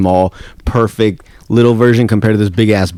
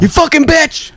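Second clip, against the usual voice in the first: high-pitched mock rage yell; mock gamer outburst; slower than usual. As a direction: squeal it like an outraged gamer. Acted outburst at the game.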